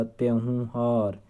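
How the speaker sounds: त्योहार drawn out very slowly; slow, careful. Shown as a demonstration. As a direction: spell out त्योहार slowly for the class. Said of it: "ये त्योहार ऐसे लिखा जाएगा". (त्योहार बहुत धीरे-धीरे)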